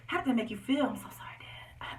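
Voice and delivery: soft whispered apology. Real, not acted. Daughter whispering sorry to her watching dad.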